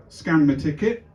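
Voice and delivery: usual speaking voice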